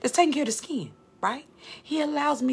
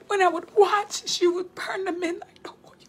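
high and crying through the words; tearful; 10 semitones higher, 3 dB louder than usual. Real, not acted. Preacher voicing God's jealous rebuke while weeping. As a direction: let the tears break the voice as you speak God's words.

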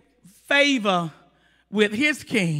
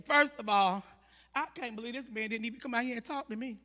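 low, hushed mock indignation; quiet, haughty grumbling; offended attitude; 3 semitones lower, 10 dB quieter than usual. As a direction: mutter it softly like an affronted big shot. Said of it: "Naaman got an attitude".

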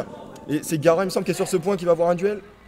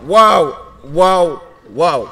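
loud stunned wows; amazed wow; 3 semitones higher, 10 dB louder, much slower than usual. Real, not acted. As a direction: gasp out wows in disbelief. Caster amazed at triple kill. (waouh stupéfait et fort)

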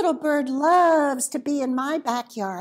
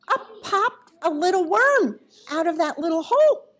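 surprise; 5 semitones higher, 4 dB louder, faster than usual. A narrator's reading. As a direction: gasp then higher, louder reveal. Surprise reveal in story.

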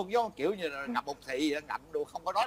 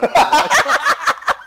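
high, loud burst of laughing; burst of laughter; 13 dB louder than usual. Real, not acted. Laughing hard while telling how they cursed him.

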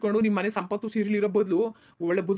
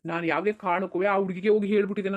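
usual speaking voice

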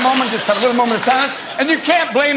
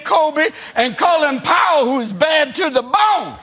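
hip hop president; 6 semitones higher than usual. Pretend. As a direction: kick it up as a rapper at the podium. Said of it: "people are hoping ... going".

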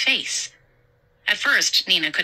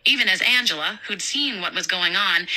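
plain reading voice

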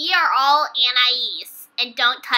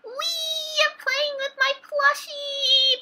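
high, drawn-out playful voice; sing-song it slowly, way up high; playful little voice; 11 semitones higher, 4 dB quieter, slower than usual. Acted. Playing with a plushie.

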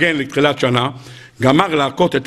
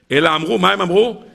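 ordinary talk, nothing marked

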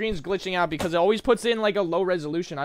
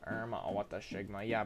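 normal talking voice

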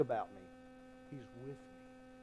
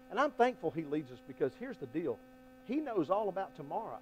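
ordinary delivery

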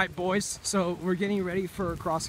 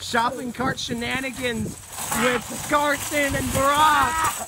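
announcer voice; 6 semitones higher, slower than usual. Acted. Announcing the show title.

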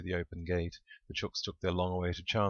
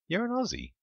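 very high-pitched disbelieving question; astonished, sky-high query; incredulous; 4 dB louder than usual. A narrator's reading. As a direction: ask it in utter disbelief, voice leaping up. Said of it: "Shep asked incredulously".

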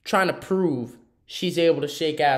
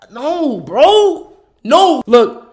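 loud, high no bro; outraged protest; 8 semitones higher, 11 dB louder, much slower than usual. Real, not acted. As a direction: shout it down in disgust. Outraged at clip.

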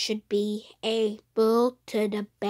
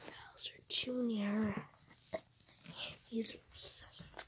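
whispering; 12 dB quieter, faster than usual. Acted. Scheming in whispers.

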